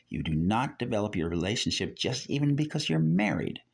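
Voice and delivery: ordinary talk, nothing marked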